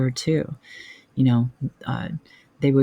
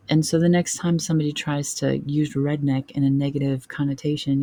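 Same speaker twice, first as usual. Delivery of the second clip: usual speaking voice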